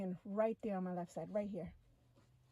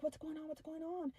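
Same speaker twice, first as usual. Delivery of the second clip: freaking out; 6 semitones higher than usual. Retold or imitated. Replaying the questions asked on the alien's table, freaking out. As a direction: replay bewildered alarm in a higher pitch. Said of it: "I'm still freaking out".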